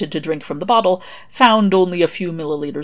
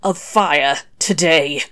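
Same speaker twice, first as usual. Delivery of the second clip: slow, worn-out croak; 6 dB louder, slower than usual. Acted. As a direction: croak it out slowly. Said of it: "he croaked out".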